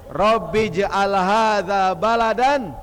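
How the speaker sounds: slow, loud chanted Arabic prayer; drawn-out Arabic prayer chant; Quranic prayer recitation. Acted. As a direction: intone the verse slowly like a reciter.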